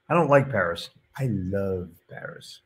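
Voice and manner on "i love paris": dreamy soft swoon. Acted. Host reads Paris greeting.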